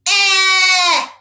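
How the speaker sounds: a long, steady EH as in bet; stretched short E. Shown as a demonstration. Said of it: "Let's do it one more time".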